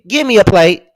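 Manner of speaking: eager demand. Real, not acted.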